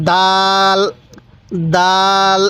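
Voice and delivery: hard, firm d opening দাল; firm pronunciation. Shown as a demonstration. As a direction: press the d of দাল, vowel held long. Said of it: "এই দালটাও আমরা শক্তভাবে উচ্চারণ করব".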